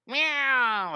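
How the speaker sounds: loud, high meow; startled cat yowl. Retold or imitated. Drawing teacher voicing the scaredy cat, loud high yowl. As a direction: imitate a scared cat's screech.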